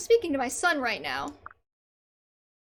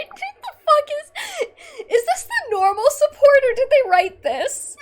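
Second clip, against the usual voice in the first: higher, louder words through laughter; laughing disbelief; 12 semitones higher, 10 dB louder than usual. Real, not acted. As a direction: laugh out the question. Streamer laughing at script.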